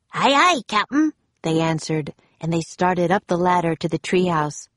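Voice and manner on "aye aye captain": high, eager henchman's reply; pirate crewman. A narrator's reading. Two pirates answer Captain Bones, high and obedient.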